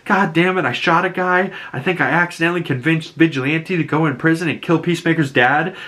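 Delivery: high, fast, rushed put-on voice; stressed confession. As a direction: blurt it out hoping nobody notices.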